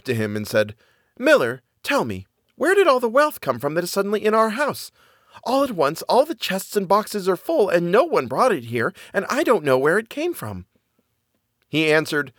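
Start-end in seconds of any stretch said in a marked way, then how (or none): 1.2-10.7 high-pitched wife full of questions; puzzled wife in a high voice; miller's wife, astonished. Acted.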